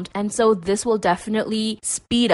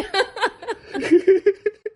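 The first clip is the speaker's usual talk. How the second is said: high laugh; 12 semitones higher, 3 dB louder than usual. Real, not acted. Laughing at own impression.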